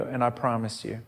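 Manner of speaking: normal talking voice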